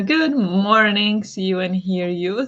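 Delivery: ordinary talk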